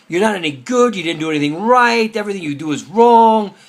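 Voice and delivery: high, loud accusing inner voice; berating yell. Retold or imitated. Yetzer Hara telling you you're worthless, berating yell. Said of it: "this guy's over here yelling at you".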